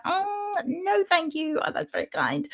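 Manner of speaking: high, soft, squeamish decline; uneasy polite refusal. Retold or imitated. Uneasy guest declining a tarot reading, high polite voice.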